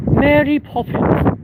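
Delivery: high, drawn-out put-on voice; put-on Mary Poppins. Shown as a demonstration. Streamer performing Mary Poppins on request, high put-on voice. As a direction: say it very high and slowly, as a character. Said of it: "Say Mary Poppins?".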